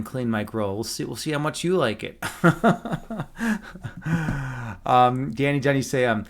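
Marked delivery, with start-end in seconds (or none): 2.3-4.8 long laugh, trailing on; teasing laugh. Real, not acted. Streamer teasing a viewer about grill cleaning, long laugh. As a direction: laugh at your own teasing offer.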